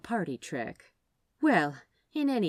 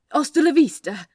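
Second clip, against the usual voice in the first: loud, higher panicked blurt; 9 semitones higher, 13 dB louder than usual. A narrator's reading. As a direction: let it escape in a flustered rush. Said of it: "Aziraphale blurted out".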